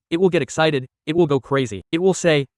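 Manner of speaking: ordinary talk, nothing marked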